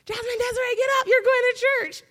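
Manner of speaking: high, loud call up to the house; mom calling out. Retold or imitated. Mother calling her daughter out to church, high loud shout. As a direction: yell her awake from the car.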